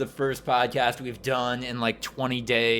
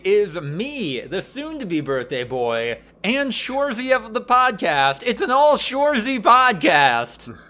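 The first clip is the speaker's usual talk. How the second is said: upbeat announcer read, bright and lifted; hammed-up radio host opening; podcast host intro; 8 semitones higher, 5 dB louder than usual. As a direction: welcome the listeners like a show host.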